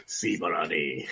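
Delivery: slow, hammed-up Spanish accent; a clumsy Spanish accent dragged out low; bad Spanish accent. Player jokes that his Spanish accent sounds Italian, a bad Spanish accent. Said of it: "my Spanish accent is my Italian accent".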